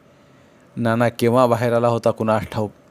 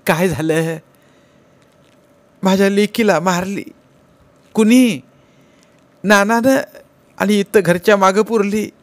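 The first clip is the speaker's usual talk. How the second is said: high, wailing cry of a grieving madwoman; distraught wailing; 9 semitones higher, 5 dB louder than usual. Acted. Madwoman wails to mother over her buried daughter. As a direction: wail it out as if your daughter was killed.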